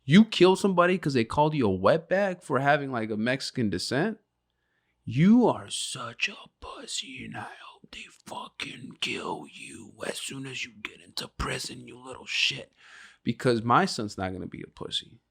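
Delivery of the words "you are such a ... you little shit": whispered contempt, hissed low; harsh whisper. Acted. Father whispering scorn at a shooter son. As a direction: spit it out in a cold whisper.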